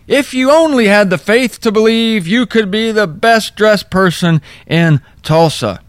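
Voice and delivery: mock the preacher's pitch; imitating the preacher. Mocking the Tulsa preacher to the congregation, hammed-up. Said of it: "the preacher saying".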